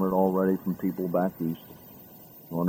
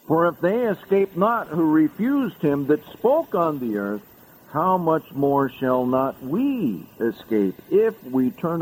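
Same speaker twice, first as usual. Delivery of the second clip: raised, slowing preacher intonation; solemn preached warning; 7 semitones higher than usual. Acted. Preacher warning the congregation from scripture, raised and slowing. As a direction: warn them gravely through the verse.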